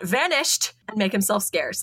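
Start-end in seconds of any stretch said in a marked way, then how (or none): 0.0-0.7 higher-pitched melodramatic exclamation; mock call-out. Podcaster imitating the mongoose ending a chat, mock melodramatic call-out. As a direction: call it out theatrically, without real volume. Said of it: "he would call out".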